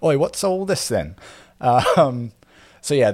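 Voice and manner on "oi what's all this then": high-pitched British bobby; British accent. Acted. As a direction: do a comic British copper catching someone out. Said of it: "trying to do a British accent".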